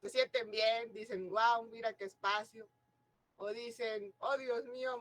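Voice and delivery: ordinary talk, nothing marked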